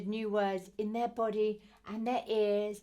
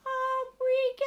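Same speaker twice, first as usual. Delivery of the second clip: much higher, swooping up on the words; a bright upward sing-song for lifting a baby; 13 semitones higher than usual. Shown as a demonstration. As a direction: lift the voice as you lift the baby. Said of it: "with a sing-song up sound".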